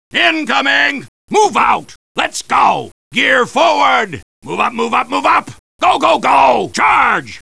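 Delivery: shouted battlefield commands; urgent orders. Acted. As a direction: drive the troops forward with a yell. Urging troops forward.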